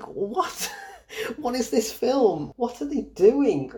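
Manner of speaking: high, slow incredulous outrage. Real, not acted. Reviewer asking the film's makers, high and incredulous. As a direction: pitch it up in disbelief, dragging the words. Exasperated reaction to the film.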